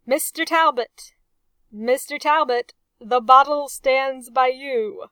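loud, commanding call across the table; ringing out. A narrator's reading. Captain calling loudly down the wardroom table. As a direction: boom it out like a captain at his table. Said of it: "rang out the voice of the captain".

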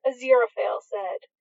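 usual speaking voice

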